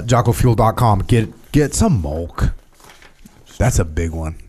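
usual speaking voice

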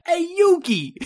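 high, loud Brooklyn Joey call; mock Joey Wheeler. Cohost mimics Joey Wheeler yelling to Yugi.

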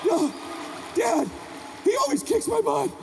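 high-pitched, mock-scared child whine; pleading little boy. Small son begs dad not to send him, pleading.

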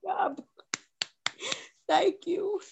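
high-pitched, overcome with emotion; tearful. Real, not acted. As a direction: thank them through tears. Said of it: "[name] over there crying".

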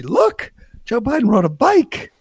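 high-pitched, gushing mock amazement; mock excitement. Host mocking cable news celebrating a candidate's bike ride, mock excitement. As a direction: marvel like an awestruck news anchor, hammed up.

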